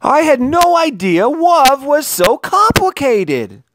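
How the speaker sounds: high, slow wondering voice; amazed boy. A narrator's reading. Leon amazed by the tangle of friends, high and slow. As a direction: marvel at it.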